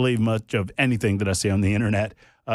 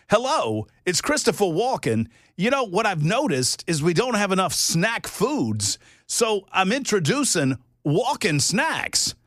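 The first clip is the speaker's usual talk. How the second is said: lightly done, higher and slow Walken-style halting voice; a hint of Walken cadence, higher and slow; Christopher Walken impression; 7 semitones higher, slower than usual. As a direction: imitate the halting pauses of a Walken read, slowly. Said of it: "did a really, really good Christopher Walken".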